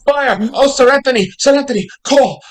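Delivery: loud, high repeated calls; panicked shouting after a runaway; frantic alarm. Acted. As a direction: cry out urgently for someone to help. Calls Sir Anthony for help.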